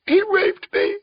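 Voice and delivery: very high, strained squeak of anguish; piercingly high, distressed cry; tiny anguished squeak. A narrator's reading. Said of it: "anguished squeak".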